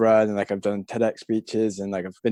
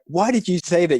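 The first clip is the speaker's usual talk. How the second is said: high, puzzled question; confused outrage, pitched up; mock bewildered reader; incredulous; 8 semitones higher, 4 dB louder than usual. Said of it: "you're like".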